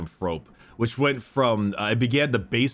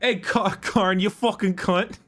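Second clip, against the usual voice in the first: a hint of a rough Aussie yell; lightly voiced Aussie shouting at a mate; Australian accent; 7 semitones higher than usual. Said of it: "That's a great Australian accent".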